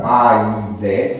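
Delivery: aides with a and i in separate syllables; vowels in hiatus. Shown as a demonstration. Teacher cites the Aeolic form, aides with vowels in hiatus. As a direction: say aides as a-i-des, rising at the end. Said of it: "cu vocalele pronunțate în hiat".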